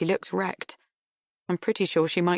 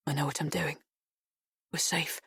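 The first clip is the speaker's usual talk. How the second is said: soft murmur; 7 dB quieter than usual. A narrator's reading. Remembered lover's soft words.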